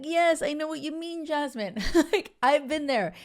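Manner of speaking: high, eager agreeing voice; mock gushing reply. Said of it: "I'm like".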